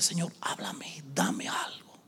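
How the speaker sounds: whispered plea to God; imitating a desperate private prayer; tearful pleading prayer. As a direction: beg in a breathy, slow whisper. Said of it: "lloraba y decía".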